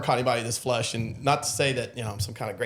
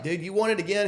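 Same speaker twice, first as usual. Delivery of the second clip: taunting; 7 semitones higher than usual. Retold or imitated. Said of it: "I'm like".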